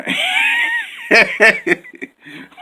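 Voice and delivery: high shrieking burst of laughter; loud laugh. Real, not acted. Vlogger laughing hard at her own no-bra joke. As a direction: crack up loudly at yourself.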